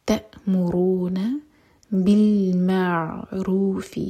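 تأمرون بالمعروف recited slowly with a heavy, full ر. Shown as a demonstration. Teacher showing the heavy ra, slow recitation. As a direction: let the ra sound heavy. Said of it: "را کو heavy پڑھا جائے گا".

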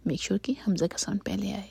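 normal talking voice

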